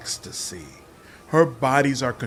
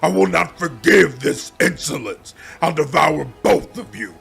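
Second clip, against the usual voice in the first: higher and louder, shouting with hatred; furious demon roar; 4 semitones higher, 6 dB louder than usual. Acted. Daskari threatening to devour the heroes in rage. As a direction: roar the threat with trembling hate. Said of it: "trembles with hatred and anger".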